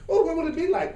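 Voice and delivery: a high, bright-eyed "oh" with a laugh; playful wonder. Shown as a demonstration. To the group, showing the attitude, high playful wonder. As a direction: muse on it like a fun daydream. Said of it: "with that kind of attitude".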